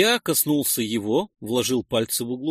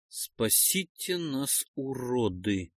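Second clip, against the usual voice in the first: slow, much softer musing; thoughtful repeat; 7 dB quieter, slower than usual. A narrator's reading. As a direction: turn the words over in your head. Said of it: "задумчиво повторил я".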